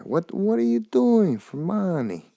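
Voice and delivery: high, slow put-on Russian accent that comes out Italian; mock Russian accent. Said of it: "I'm Italian when I mock them".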